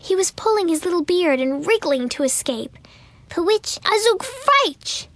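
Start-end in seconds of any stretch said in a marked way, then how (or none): 3.3-5.1 put-on crying gnome child. A narrator's reading. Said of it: "he cried".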